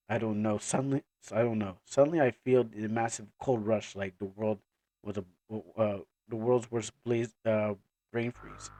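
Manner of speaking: low, soft, puzzled voice; dazed Ayer. Acted. Ayer describing a cold rush, low and dazed. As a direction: drop low and quiet, groping for words.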